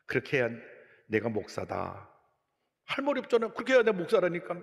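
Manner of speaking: normal talking voice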